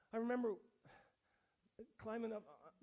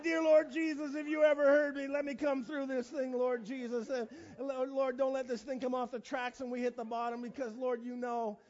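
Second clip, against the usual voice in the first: panicked prayer; 2 semitones higher, 7 dB louder than usual. Retold or imitated. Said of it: "more in prayer than it was in screaming".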